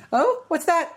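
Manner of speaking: high-pitched mock panic; squeaky-high imitation of hidden alarm; retold freak-out. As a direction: feign innocence while panicking, voice way up. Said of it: "just kind of freaking out".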